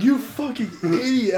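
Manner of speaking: high, loud retold jeer; mocking brother. Retelling brother mocking the burning eyes, loud jeer. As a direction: imitate a brother jeering through laughter.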